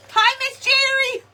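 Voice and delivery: loud, high greeting across the yard; bright called-out hello; calling out. Real, not acted. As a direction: call out to a neighbor. Greeting someone from afar.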